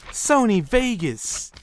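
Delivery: high, drawn-out mocking quote; mock boast. Mimicking people who claim they use Sony Vegas, mocking. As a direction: stretch it out high to mimic the braggers.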